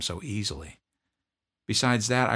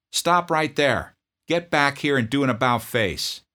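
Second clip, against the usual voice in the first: loud, higher, curt demand; drill sergeant; 8 semitones higher, 7 dB louder than usual. Retold or imitated. Drill sergeant confronting a sniffling private, loud bark.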